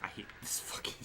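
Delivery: soft whispered swearing; whispered curse. Real, not acted. Gamer whispering his hatred of the game. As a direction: breathe the words out in annoyance.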